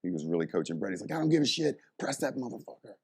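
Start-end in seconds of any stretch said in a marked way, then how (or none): 1.1-2.9 higher-pitched, fired-up coach impression. Coach pushing the assistant to press, imitated fired-up voice. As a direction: imitate a coach urging on his lifter. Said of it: "he got real fired up".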